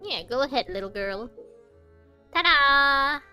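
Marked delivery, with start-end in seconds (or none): 2.3-3.2 loud, high "ta-da"; big, showy unveiling; playful reveal. Pretend. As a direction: present it with a sung-out flourish. Presenting the opened gift.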